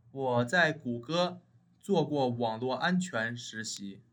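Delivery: ordinary talk, nothing marked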